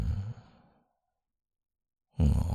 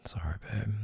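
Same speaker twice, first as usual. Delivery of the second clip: whisper; 5 semitones higher, 12 dB quieter than usual. Acted. Apology after argument.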